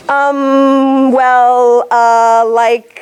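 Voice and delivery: hesitant "um, well, uh" strung together; rambling hesitation sounds; filler demo. Shown as a demonstration. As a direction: act out a speaker full of ums. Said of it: "the ums and the ems".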